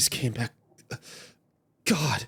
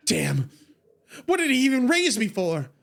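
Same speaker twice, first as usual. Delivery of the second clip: higher, louder cry of betrayal; anguished outburst; 10 semitones higher, 4 dB louder than usual. Acted. Faye rages at her grandfather, loud anguish. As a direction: throw the question at the sky.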